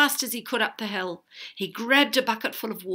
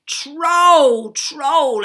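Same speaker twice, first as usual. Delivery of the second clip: long, high, loud alarm; shouting at full voice; 8 semitones higher, 14 dB louder than usual. A narrator's reading. Bored Arabella tricking townsfolk, shouting. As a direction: stretch the shout out as far as it carries. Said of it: "at the top of her voice".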